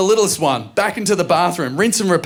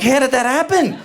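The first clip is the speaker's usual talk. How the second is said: much higher, disbelieving cry; shocked high voice; 11 semitones higher than usual. Retold or imitated. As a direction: shriek in comic disbelief. Dad shocked at poo.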